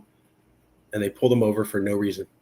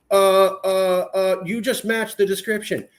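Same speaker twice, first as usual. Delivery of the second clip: higher, stuttering cop voice; flustered cop; 6 semitones higher, slower than usual. Retold or imitated. Officer repeating his excuse, higher stutter. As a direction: stall with uh uh.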